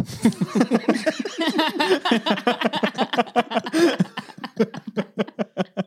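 long, high laughing fit; high helpless laughter. Real, not acted. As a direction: crack up completely. Teller laughs at own joke.

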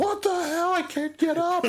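high, loud panicked yelp; mock panic. Retelling being stuck in the haunted wall, high panicked yelp.

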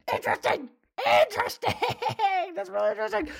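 high-pitched laughing interesting. Retold or imitated. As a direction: squeak it out through laughter. Catching friends making out.

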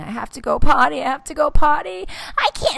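put-on high, loud whining child; whiny kid. Mom imitating her son in the car, loud and whiny. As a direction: whine like a desperate toddler. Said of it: "Levi's complaining".

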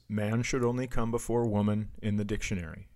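normal talking voice

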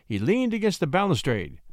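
ordinary delivery, nothing marked

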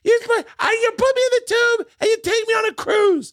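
imitated high-pitched indignant yelling; furious Bobby. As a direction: argue back in a hammed-up squawk of outrage. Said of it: "fucking furious".

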